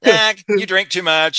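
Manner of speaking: a playful mock turn-down; teasing refusal. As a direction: wave him off lightly. Retells Esther turning down Norman.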